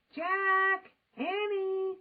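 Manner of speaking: loud high call from far away; distant call. A narrator's reading. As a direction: call the kids again. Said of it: "came the distant cry again".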